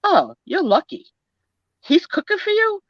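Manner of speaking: high-pitched mom, marvelling; imitated mother. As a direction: imitate mom's high, incredulous question. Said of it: "And she'd be like".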